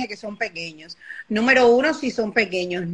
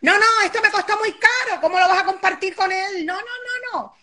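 much higher, fast indignant protest; possessive parent; 9 semitones higher than usual. Retold or imitated. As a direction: refuse sharply like a stingy parent. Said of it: "Eso, que se lo compre".

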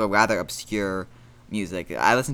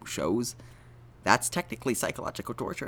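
ordinary talk, nothing marked

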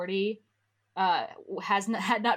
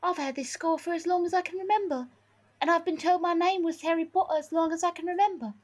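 high put-on boy-wizard voice; Harry; 10 semitones higher than usual. Harry explaining his scar and name, high character voice. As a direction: play the young wizard, pitched well up.

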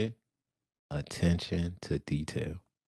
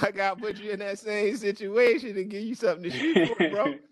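high-pitched, laughing through the words; amused, squeaky-high delivery; laughing tease; 13 semitones higher, 3 dB louder than usual. Real, not acted. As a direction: tease warmly, voice riding up on a laugh. Teasing guest with heavy question, laughing.

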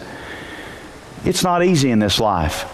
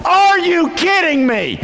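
slow, high, disbelieving complaint; exasperated disbelief; 13 semitones higher, slower than usual. Retold or imitated. Joseph to the innkeeper, high and slow in disbelief. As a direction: stretch it out like you can't believe it. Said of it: "take a couple of steps back and go".

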